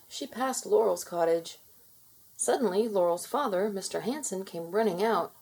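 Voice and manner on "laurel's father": plain reading voice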